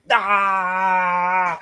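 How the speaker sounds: long drawn-out "aah" of frustration; frustrated cry. Acted. Quest-giver swiping his swords about, frustrated cry.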